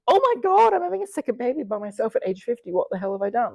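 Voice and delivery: a hammed-up oh-my-God panic; imagining the shock of a second baby; mock panic. Imagined panic at positive test.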